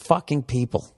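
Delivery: ordinary delivery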